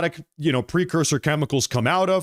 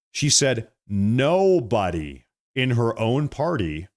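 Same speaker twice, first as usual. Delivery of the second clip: ordinary talk, nothing marked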